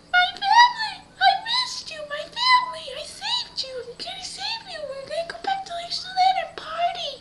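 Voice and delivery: very high, gushing squeaky voice; hammed-up joyful squeal. Girl reunited with her lost family, high squeal. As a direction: squeal with overdone, near-tearful relief.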